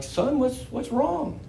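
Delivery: a light take on a worried dad checking on his son; lightly voiced concerned father; gentle father. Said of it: "And he's like".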